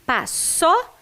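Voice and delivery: "Paçoca" broken into slow syllables, stopping on the ço; sounding out syllables. Shown as a demonstration. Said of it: "pra fazer o som de só". (soletrando por sílabas, devagar)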